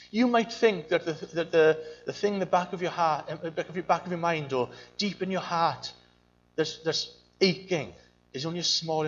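normal talking voice

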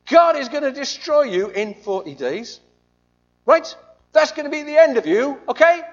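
high, loud proclamation of doom; loud warning. Retold or imitated. Jonah proclaiming doom to the Ninevites, high and loud. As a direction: announce destruction to the crowd. Jonah warns city loudly.

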